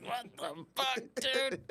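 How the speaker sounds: high, squeaky what the fuck; outraged disbelief. Retold or imitated. Retelling his reply to Spencer, high mock outrage. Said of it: "Spencer Cavens was like".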